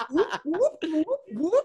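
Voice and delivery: high cartoon whoops; repeated high whoop like a slide being pulled; imitated pulling noise. Friend miming a giant Q-tip pulled out, comic whooping.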